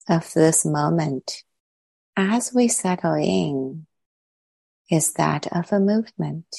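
calm, unhurried meditation voice with long pauses; guided meditation. Real, not acted. Teacher settling the group into body and breath, calm guided meditation. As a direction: guide them gently inward, leaving long silences between phrases.

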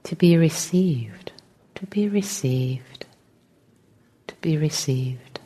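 guided meditation. Real, not acted. Teacher settling the group into meditation, calm and unhurried. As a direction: gently guide them into stillness, phrase by phrase.

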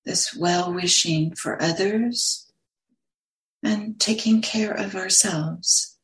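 unhurried meditation guidance with long pauses; lingering on words, leaving long silences between phrases; guided meditation. Acted. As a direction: guide listeners gently into stillness, phrase by phrase.

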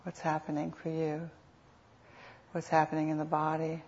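unhurried meditation guide, long pauses between phrases; guided meditation. Real, not acted. Teacher guiding sitters to notice what is happening, calm meditation voice. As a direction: lead them gently, phrase by phrase, leaving space.

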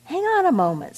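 a squeaky little creature objecting; worm voice. A narrator's reading. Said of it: "said the worm".

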